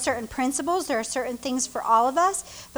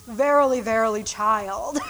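solemn father; 4 semitones lower than usual. Pretend. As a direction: play an old-fashioned formal dad. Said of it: "always talked to me with this".

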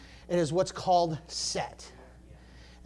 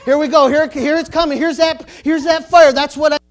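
higher, louder, eager outburst; excited inner voice; 7 semitones higher, 11 dB louder than usual. Retold or imitated. Preacher voicing his spirit man being stirred, excited. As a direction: burst out as the fire hits.

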